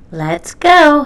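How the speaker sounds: loud, high cheer to get going; excited yell. A narrator's reading. Splat yelling from his seatbelt, loud and eager. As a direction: shout it out, eager to leave. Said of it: "he yelled".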